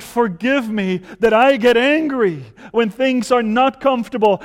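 loud, high, climbing prayer of repentance; fervent prayer. Acted. Preacher leading the congregation in prayer, loud and fervent. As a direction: pour it out, building to a cry.

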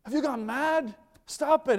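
high, loud scolding outburst; upset parent. Acted. Parent to a child reaching for the fire, upset scolding. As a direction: scold in alarm to stop a child at once. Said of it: "You get upset".

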